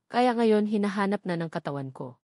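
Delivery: plain reading voice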